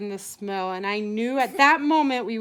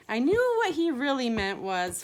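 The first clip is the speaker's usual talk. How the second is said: ordinary delivery, nothing marked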